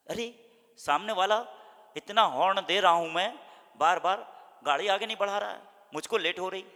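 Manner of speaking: loud, high irritated outburst; angry driver. Retold or imitated. A late driver stuck behind a car, angry and loud. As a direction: snap at the car ahead, impatient. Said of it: "गाड़ी चला रहे हैं".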